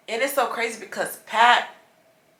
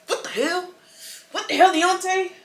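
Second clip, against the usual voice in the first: exasperated outburst; 11 semitones higher, 3 dB louder than usual. Real, not acted. Exasperated outburst at her stuffed nose.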